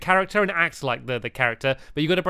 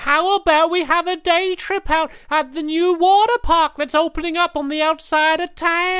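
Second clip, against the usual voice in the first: raised-pitch playful lizard voice; gecko character voice; 13 semitones higher, 4 dB louder than usual. Acted. Gecko greeting his old friend Betsy, raised playful character voice. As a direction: play the fussy old gecko catching up.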